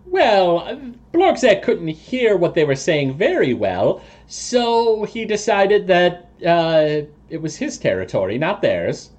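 high toad voice, hedging; evasive toad creature. Acted. Toad person dodges question about eavesdropping, high and hedging.

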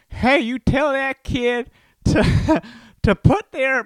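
high, loud, slow mock parent shout; mock yelling parent. Pushy parent yelling at the drama teacher, loud and high. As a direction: yell it like a pushy theater parent. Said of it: "start yelling at the drama teacher".

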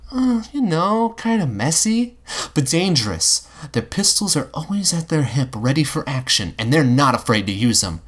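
put-on boy describing pirates to a robot, pondering; young Will. A narrator's reading. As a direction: muse like a boy picturing pirates.

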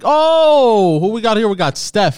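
loud, high, drawn-out whoop easing into a welcome; excited greeting. Real, not acted. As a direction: hail the new arrival like a hype host. Greets a viewer joining live chat.

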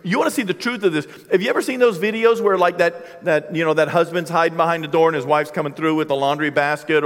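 ordinary delivery

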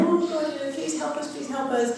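high, hushed desperate plea; pleading cry. Retold or imitated. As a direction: beg God softly and high. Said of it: "they cry out to God, saying".

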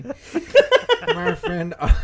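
high, loud, slow through laughter; helpless laughter. Real, not acted. Host introducing a friend's mnemonic, helplessly laughing. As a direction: try to get it out while cracking up.

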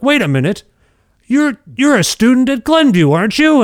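high, loud and suspicious, imitating the principal; stern vice principal. Imitating the vice principal recognizing the student in the crash. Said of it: "he's like".

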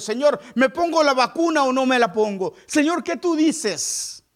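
high, loud mock-anxious plea; fretting believer's prayer. A worried believer asks God about the vaccine, high and loud mock fret.

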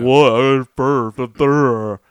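snotty put-upon voice, drawn out; mock rude traveler. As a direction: sneer like a rude stranger at the gate. Mocking rude seat-hogger at gate.

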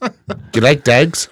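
lightly voiced Irish Traveller accent; thick-vowelled pikey question, rising. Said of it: "That's a pikey".

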